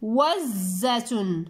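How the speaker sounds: إوزة slowly as one joined word, doubled z held, ending -tun; slow, careful إوزة with no breaks; whole word, drawn out. Shown as a demonstration. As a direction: join إوزة up, stretching it slowly to a final -tun. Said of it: "لاحظوا معي واسمعوا جيدا".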